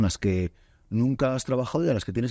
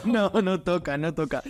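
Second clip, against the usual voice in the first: laughing it off, higher; 8 semitones higher than usual. Real, not acted. Host laughing off how long they've known each other. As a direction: brush off the question through a laugh.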